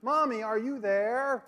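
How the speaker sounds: put-on boy calling for mommy again in the dark; little boy calling out. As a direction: stretch it out like a small boy calling a third time. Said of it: "The voice came out of the darkness the third time".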